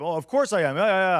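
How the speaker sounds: hammed-up guilty excuse; mock defensive. Speaker mimics caught boss.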